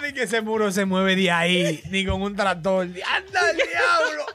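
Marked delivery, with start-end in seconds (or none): 2.9-4.2 slow, laughing cry-shout. Real, not acted. A friend reacts to the story, laughing and shouting slowly. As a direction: howl it out while cracking up.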